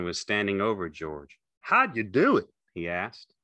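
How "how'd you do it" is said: high, loud questioning; Carlson, demanding. A narrator's reading. Carlson standing over George, loud demanding question. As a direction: question him in a raised, high voice.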